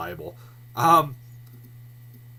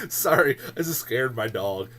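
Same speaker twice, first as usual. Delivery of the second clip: ordinary talk, nothing marked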